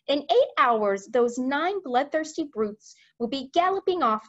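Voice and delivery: high questions settling into firm orders; rally the military men, starting high; urgent Queen. Acted.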